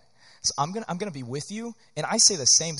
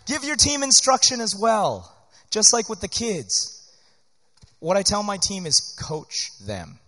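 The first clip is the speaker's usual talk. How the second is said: ordinary talk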